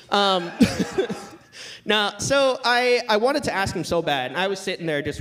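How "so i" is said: ordinary talk, nothing marked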